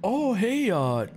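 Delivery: very high, chirpy guest voice; high party guest voice. Pretend. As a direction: greet the host in a squeaky high voice.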